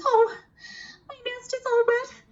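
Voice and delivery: very high, worried hedgehog voice; a tiny hedgehog fretting over cold babies; tired hedgehog voice. Acted. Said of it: "Miss Prickle says".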